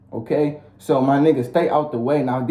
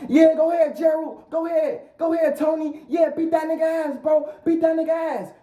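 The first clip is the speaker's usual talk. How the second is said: high-pitched hype-man voice, much higher than usual; egging-on homeboys; 12 semitones higher than usual. Acted. Homeboys urging Tony into a fight, high-pitched goading. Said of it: "for they homeboys to be like".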